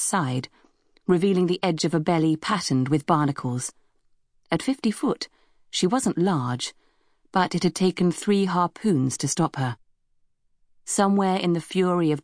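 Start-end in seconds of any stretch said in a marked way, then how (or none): none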